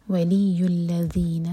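ولی الذین with the ya doubled and pressed; strong doubled ya. Shown as a demonstration. Teacher models the shadda on ولی, slowly. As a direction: lean on the ya of ولی, very slow. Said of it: "یا کو strong پڑھیں گے".

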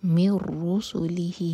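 من رسلہ said as mirrusulih, نون dropped into را; merged nun. Shown as a demonstration. Teacher models idgham without ghunna slowly. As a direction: join the م straight to را in من رسلہ. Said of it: "ادغام بغیر غنہ کے".

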